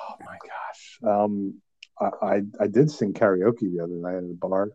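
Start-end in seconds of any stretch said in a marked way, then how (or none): 0.1-1.0 whisper. Real, not acted. Embarrassed after bursting into song.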